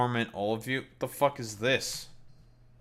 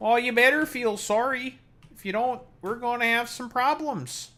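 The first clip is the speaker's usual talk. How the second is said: much higher hammed-up Canadian accent, mock threatening; mock Canadian threat; 10 semitones higher than usual. Streamer warns chat, mock Canadian accent threat. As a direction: put on the Canadian voice and warn them.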